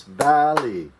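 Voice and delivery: "Valley" split clearly as VAL-ley; two clear syllables. Shown as a demonstration. Teacher showing the class the word's two beats, clearly. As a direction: tap out both beats of "Valley". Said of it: "Two syllables".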